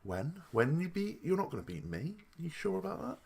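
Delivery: a lightly voiced Sasha, scoffing in disbelief; mock incredulous Sasha. Sasha hearing Ronda claim she'll beat her, mock disbelief. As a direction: pitch leaps up on the questions, voice drops soft. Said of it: "Sasha Banks' face was like".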